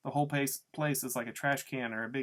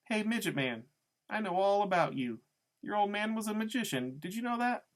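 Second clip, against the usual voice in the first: taunting woman in a high voice; Loretta; 8 semitones higher than usual. A narrator's reading. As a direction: tease the boy, pitch climbing to the final question. Narrator voices Loretta taunting Freak.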